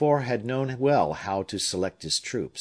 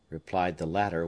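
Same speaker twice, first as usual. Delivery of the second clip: ordinary delivery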